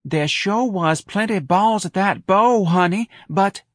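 slow, high, mincing stage delivery; demure Southern belle. A narrator's reading. Darlene fluffing her line on stage, affectedly demure. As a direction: recite it coyly through a rosebud pout. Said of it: "making a rosebud of her mouth".

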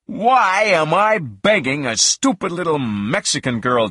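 mildly shouted angry outburst, starting high. A narrator's reading. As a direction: raise the voice in anger, then drop. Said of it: "then I got angry".

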